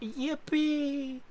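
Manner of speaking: very high, drawn-out cheer; high squealed yippee. Real, not acted. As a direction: squeal it in delight. Echoed cheer.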